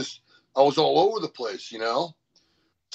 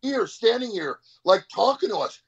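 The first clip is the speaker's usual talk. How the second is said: higher, incredulous, faster in the middle; can't believe he's standing there alive; retold disbelief; 8 semitones higher than usual. Mark Chalet stunned the lifter survived, higher incredulous voice. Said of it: "and he goes".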